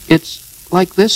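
high, loud, quick interjection. A narrator's reading. Piglet jumps in to explain for Pooh, quick. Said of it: "said Piglet quickly".

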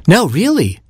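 high firm little bird. Acted. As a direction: insist in the robin voice.

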